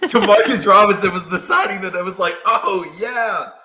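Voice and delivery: laughing at the Demarcus Robinson signing. Real, not acted. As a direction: chuckle it out in disbelief.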